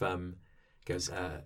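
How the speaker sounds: ordinary talk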